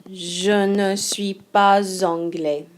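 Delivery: "pas anglais" linked as "pa-zanglais"; slow careful French, "pas anglais" run together with a z; with liaison. Shown as a demonstration. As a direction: join "pas" to "anglais" with a z sound. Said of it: "this is actually gonna create a liaison".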